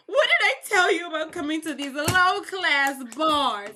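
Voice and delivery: high, put-on overbearing mother; hammed-up fretting mom voice; scolding mother. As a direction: scold your son in mock distress. Mother scolds injured son in story.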